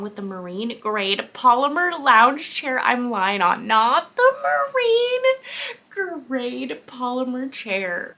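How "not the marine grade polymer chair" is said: high, loud, drawn-out squeal; mock dismay. Real, not acted. Reader squealing over a book detail, mock dismay. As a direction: wail it slowly in exaggerated disbelief.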